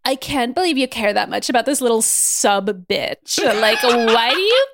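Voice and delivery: put-on disdainful villainess voice; hammed-up haughty villain; Celine. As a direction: sneer it theatrically, stretching the last word. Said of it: "Her voice is so funny".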